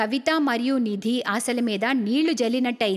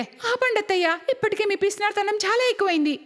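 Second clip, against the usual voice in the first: put-on higher-pitched, firm young woman; defiant daughter-in-law; 6 semitones higher than usual. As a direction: cut your mother-in-law off, pitched up. Daughter-in-law stands up to elder. (హెచ్చు స్వరంలో ఎదిరించే కోడలు)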